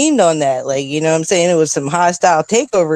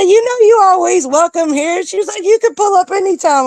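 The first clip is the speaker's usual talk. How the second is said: welcoming friend's mom; 14 semitones higher than usual. Retold or imitated.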